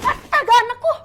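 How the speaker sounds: a very loud, high horrified shriek; a mother's panicked scream for her child; horrified cry. Acted. Mother sees daughter in danger. (jeritan ibu yang panik, keras dan tinggi)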